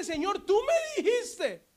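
high, slow plea with a laugh in it; Moses pleading. Acted. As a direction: plead with God, half laughing. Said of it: "Moisés le dice". (Moisés suplicando agudo y lento, riendo)

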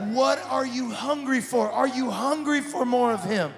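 full-force preaching. Acted. Pastor urging the congregation, loud full-force preaching. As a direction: preach it to the back row.

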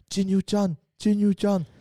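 high and urgent dying plea; weak dying voice, pleading. A narrator's reading. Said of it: "wiederholte der Patient". (flehende, hohe Sterbestimme)

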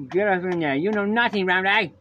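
loud, high-pitched hammed-up taunt; mock character outburst. Riffing on silly nicknames, hammed-up loud taunting. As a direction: build into a mock-scolding yell.

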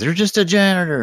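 high, loud mocking jab; mocking taunt. Howard taunting the lab tech, high mock jab. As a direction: sneer the put-down up high.

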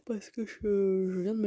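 words stretched by a yawn. Real, not acted. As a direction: yawn mid-sentence. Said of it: "et je baille".

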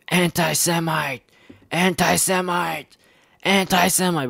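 high-pitched imitation of screamed accusation; mock scream. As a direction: cry it out high, mocking their screaming. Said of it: "Then they scream".

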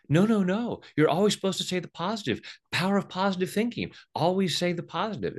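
high, loud, insistent mock lecture; mock positive-thinking preacher. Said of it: "they'll say".

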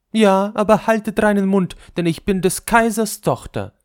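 hoch und laut, hochmütige Prinzessin; hohe Prinzessinnenstimme. A narrator's reading. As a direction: spiel die stolze Kaisertochter. Prinzessin spricht zu den Hofdamen. (hohe, hochmütige Prinzessin)

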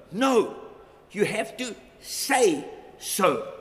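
forceful, slow pulpit declaration; loud preached insistence. Acted. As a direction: drive it home to the congregation. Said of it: "preaching climax".

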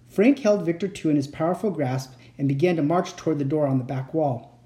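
usual speaking voice

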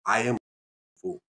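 put-on African accent with weighty pauses. Dad finishes explaining the proverb, African accent. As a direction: wrap up the lesson in an African accent. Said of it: "African accent".

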